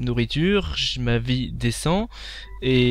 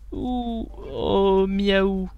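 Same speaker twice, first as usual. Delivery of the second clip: higher, yelping little cat sounds and a meow; playful cat noises; 7 semitones higher, much slower than usual. Pretend. Lion cub bitten by dinosaur, playful mewing. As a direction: play the hurt kitten for laughs.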